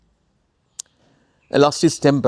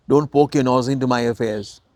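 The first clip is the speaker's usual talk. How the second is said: ordinary talk, nothing marked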